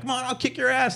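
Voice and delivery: put-on high taunting kid voice; mock kid threat. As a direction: play the cocky middle schooler. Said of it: "he starts really being like".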